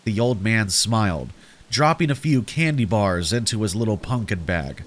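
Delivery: ordinary delivery, nothing marked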